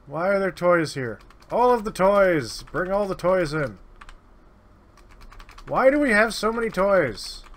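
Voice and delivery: high, loud disbelieving rant; loud exasperation. Real, not acted. Player ranting at the trade goods: loud exasperation. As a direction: shout at the pile of toys.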